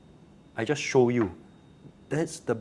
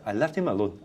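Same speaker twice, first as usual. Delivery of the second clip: normal talking voice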